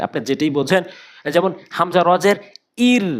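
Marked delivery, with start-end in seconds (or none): 2.9-3.1 ইর said light with wide mouth; thin ir. Shown as a demonstration. Teacher showing the light ইর. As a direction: spread the lips for a thin ইর. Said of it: "মুখটা কিন্তু ওসার".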